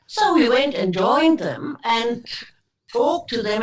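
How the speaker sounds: ordinary talk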